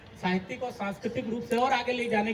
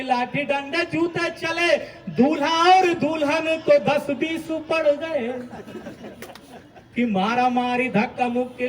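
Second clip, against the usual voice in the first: loud, rhythmic stage verse, rising at line ends; comic verse recitation; 3 semitones higher, 7 dB louder, slower than usual. Acted. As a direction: belt the comic couplets out to the crowd. (ज़ोरदार लयबद्ध हास्य कविता पाठ)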